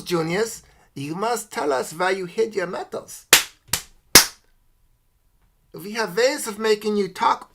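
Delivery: high, menacing foreign interrogator voice; cool foreign spy menace. Pretend. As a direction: loom over a captive and demand answers. Plays an interrogator demanding metals.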